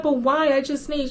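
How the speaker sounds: high, pleading put-on grandma voice; a pretend old lady protesting; fake grandma whine. Fake grandma begging the supervisor for help. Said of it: "She was like".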